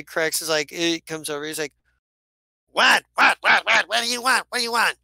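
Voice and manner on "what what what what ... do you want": rapid-fire high, loud barks; irritated shouting. Acted. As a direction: snap back at someone pestering you. Captain irritably answers the yelling.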